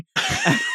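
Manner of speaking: shrill embarrassed squeal laugh; high squeal. Retold or imitated. Squeal after confession.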